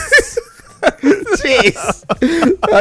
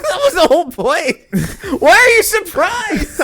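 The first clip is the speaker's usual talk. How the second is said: laughing; 6 dB louder than usual. Real, not acted. Laughing at friend's reaction.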